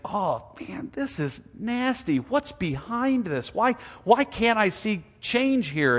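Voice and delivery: high-pitched, drawn-out bewildered questioning of God; dismayed questioning. Acted. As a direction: act out a troubled believer asking why. Believer pleading with God, acted.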